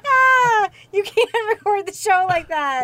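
high-pitched, breathless laughing fit; can't stop laughing through words; hysterical laugh. Real, not acted. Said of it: "why are you laughing so hard".

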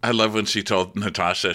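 ordinary talk, nothing marked

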